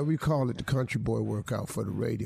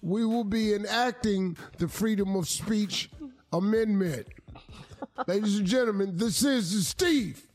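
booming announcer over a loudspeaker; over a PA system; 7 semitones higher than usual. Acted. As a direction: announce it like a stadium PA. Mock official show announcement.

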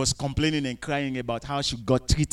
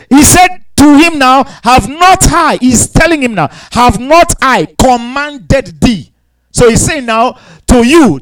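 loud, driving sermon, pitch raised high; preaching at full force; 5 semitones higher, 13 dB louder than usual. Acted. Pastor exhorting the congregation, preaching at full force.

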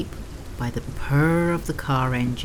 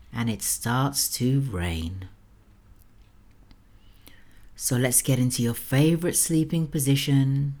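sultry narrator. Acted. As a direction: lull the listener toward sleep. Sleep story host voice.

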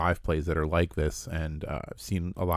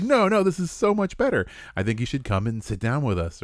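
eager, higher-pitched coaxing; pushy persuasion; 6 semitones higher than usual. Acted. Game master bullying friends into playing, pushy coaxing. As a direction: talk them into it, starting high then easing. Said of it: "you can start bullying 'em".

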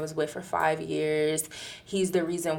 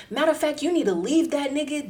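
high-pitched, insistent mock rant; retold going off; 8 semitones higher, faster than usual. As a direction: urge a friend firmly, imitating his outrage. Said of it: "he just started going off".

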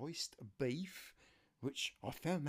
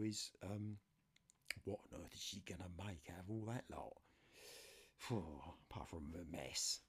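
ordinary talk

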